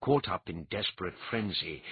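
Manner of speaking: ordinary delivery, nothing marked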